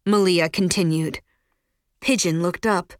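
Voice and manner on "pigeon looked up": ordinary delivery, nothing marked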